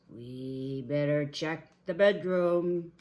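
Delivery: slow, booming bear voice; Papa Bear. Acted. Papa Bear leading on to the bedroom, booming. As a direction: announce it slowly and loudly.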